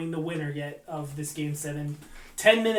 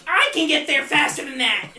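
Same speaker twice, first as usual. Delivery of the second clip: much higher, louder laughing jab; mock Kessel scolding; 11 semitones higher, 7 dB louder than usual. Imagined coach calling someone lazy, high and loud. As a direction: scold a player in his voice. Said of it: "calling somebody else lazy too?".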